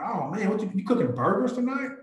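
high, curious friendly question; chummy surprise. Retold or imitated. Agent quizzing a shopper about burgers, high and amazed. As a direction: sound amazed at the mustard. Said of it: "but I'm like".

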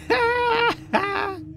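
high-pitched burst of laughter; cracking up. Real, not acted. Player amused by own dirty joke, high squealing laugh.

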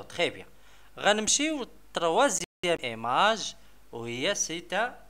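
normal talking voice

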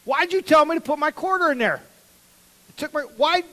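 high, loud indignant wail; kid's outraged cry. Retold or imitated. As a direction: cry out like a robbed kid. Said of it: "I'm like".

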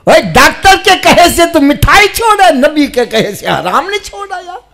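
loud, high shouted reproach; impassioned pulpit scolding; full-force preaching rebuke. Real, not acted. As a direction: cry it out at the listener.

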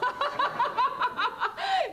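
high mocking laugh; gloating cackle. Farmer's wife laughing triumphantly at Lieschen, mocking cackle. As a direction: burst out laughing at her rival's setback.

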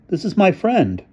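high, proud introduction; young man voice. A narrator's reading. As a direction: present her with pride. Arnie introduces his girlfriend.

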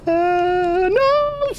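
sudden frightened yelp; high scared yelp. Real, not acted. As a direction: squeal no in fright. Jump scare reaction.

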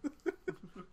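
high, cheery put-on soldier voice; silly Mason voice. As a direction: greet the enemy in a goofy high voice. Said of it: "What if Mason talked like that back then?".

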